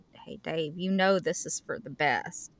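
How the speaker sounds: low, soft consoling voice; mock condolence. Friend consoling Dave at my funeral, low and soft. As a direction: quietly console a widower, in pretence.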